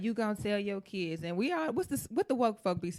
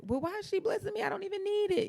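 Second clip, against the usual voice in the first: puzzled inner voice; 13 semitones higher, faster than usual. Retold or imitated. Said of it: "And I felt like".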